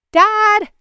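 put-on high, loud child's call. A narrator's reading. Girl calling her father from the car, loud and high. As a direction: yell to dad from the car window.

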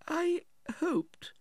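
very high, hesitant widow's voice; faltering. A narrator's reading. Widow begins her appeal to brother-in-law, faltering. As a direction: falter timidly, pitched far up. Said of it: "faltered Missus Nickleby".

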